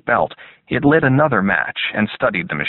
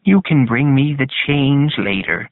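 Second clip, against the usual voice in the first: higher-pitched, put-on old lady; little old lady voice; 4 semitones higher than usual. A narrator's reading. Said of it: "a convincing little old lady voice".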